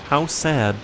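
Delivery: gently sorry, faked sincerity; mock sincere sadness. Player voicing fake pity at a fading ghost, mock sincere. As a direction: sound sorry without meaning it. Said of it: "I like how I say that so genuinely".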